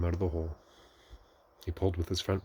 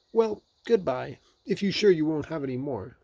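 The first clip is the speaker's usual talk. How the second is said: Rabbit seeing off; 9 semitones higher, 5 dB louder than usual. A narrator's reading. Rabbit seeing Pooh off, high voice.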